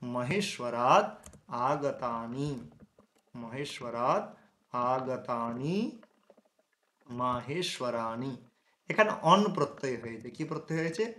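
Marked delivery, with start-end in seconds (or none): 0.0-2.7 drawn-out mহেশ্বরাদ আগতানি spelled out slowly; slow, syllable by syllable. Shown as a demonstration. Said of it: "কি বললাম?". 3.3-8.4 মহেশ্বরাদ, then আগতানি, each drawn out with a rising end; very slow, word by word. Shown as a demonstration. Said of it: "কি বললাম?".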